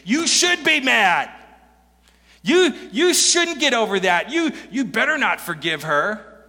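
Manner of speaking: egging-on voices. Retold or imitated. Friends and culture urging the listener to stay angry, heated. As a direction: friends urging you to hold a grudge. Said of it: "voices ... that say".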